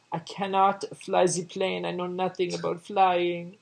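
high, put-on French accent; French woman voice. French woman quoted in retelling.

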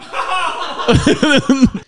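high giggle at own joke; high-pitched laugh. Real, not acted. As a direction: burst into high, tickled laughter.

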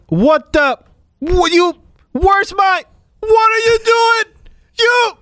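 high, rising outraged yell; a patient waking up without his tooth, furious. Retold or imitated. Retold outburst at dentist.

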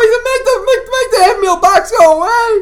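very high, loud hammed-up crying plea; a scared child's hammed-up wail; mock terrified wail. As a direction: beg in fake horror. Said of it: "I'm like".

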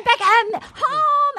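high, drawn-out singsong call; mock homecoming call. Imitating a man announcing himself to Becca, high drawn-out call. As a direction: call it out as if coming through the door. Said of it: "then he said".

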